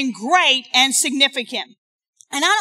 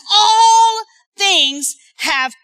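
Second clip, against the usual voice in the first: slow, loud and higher; drawn out; 6 semitones higher, 5 dB louder, much slower than usual. Real, not acted. Preacher reading scripture to the congregation, slow and loud. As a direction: stretch every word.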